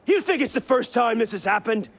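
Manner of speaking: high-pitched shouted tirade; angry tirade. Acted. Veteran assassin justifies purging the leadership, angry tirade. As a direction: rail at him, question upon question. Accused man rants back.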